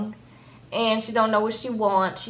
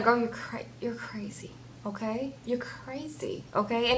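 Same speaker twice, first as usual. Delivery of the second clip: near-whisper, much softer; hushed retold aside; 11 dB quieter than usual. Friend in the middle to Angela, hushed aside. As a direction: say it under your breath, imitating him.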